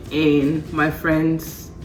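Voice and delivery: ordinary talk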